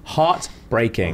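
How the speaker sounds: slow, stretched-out word; weighty, lingering delivery; heartfelt emphasis. Real, not acted. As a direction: draw out each half of the word. Moved by the contestant's innocence.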